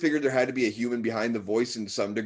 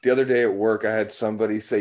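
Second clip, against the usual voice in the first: ordinary delivery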